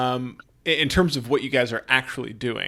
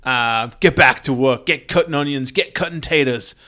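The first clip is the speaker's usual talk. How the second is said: loud, scolding kitchen boss; hammed-up impatient chef ordering cooks around; angry head chef; 6 dB louder than usual. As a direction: bawl the cooks back to work. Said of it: "the head chef bonks you each".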